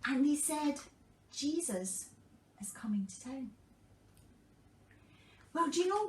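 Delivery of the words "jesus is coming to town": hushed whisper of the crowd. Acted. Crowd whispering to the blind man that Jesus is coming. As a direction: breathe it out as a secret whisper.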